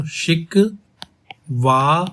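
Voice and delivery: "शिकवाए" stretched very slowly with the ए rising; slow rising "शिकवाए" demo; drawn-out pronunciation. Shown as a demonstration. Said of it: "इसको लिखने की कोशिश करो".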